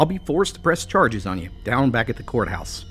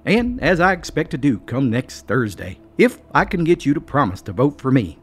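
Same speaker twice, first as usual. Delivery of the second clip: hearty chuckle; 6 semitones higher than usual. A narrator's reading. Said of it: "with a hearty chuckle".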